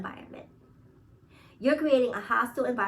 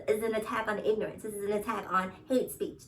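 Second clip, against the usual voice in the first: usual speaking voice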